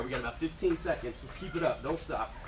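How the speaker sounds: normal talking voice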